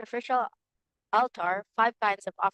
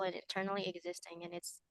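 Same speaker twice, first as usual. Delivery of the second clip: faint, far from the mic; reading almost too softly to hear; barely audible; 11 dB quieter than usual. Real, not acted. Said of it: "very low".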